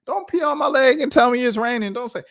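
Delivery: high emphatic warning; exasperated scold. Real, not acted. Host scolding the podcaster for gaslighting, high and fed up. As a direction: lay it down, fed up.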